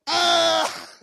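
put-on high, loud boy's scream; retold scream. Pastor reenacting his childhood scream for the kids, loud yelp. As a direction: let out a yelp like a shocked kid. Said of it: "I screamed as loud as I could".

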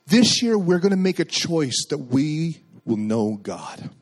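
measured, earnest sermon call to the congregation; preaching exhortation. Acted. Pastor urges the congregation toward the new year, earnest preaching. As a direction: urge the church to step out, building.

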